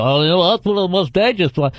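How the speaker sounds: lightly put-on parroting voice; mocking know-it-alls. Sick of non-doctors repeating cancer facts, lightly mocking imitation. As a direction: parrot the amateur doctors with scorn. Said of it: "so sick of people who aren't doctors".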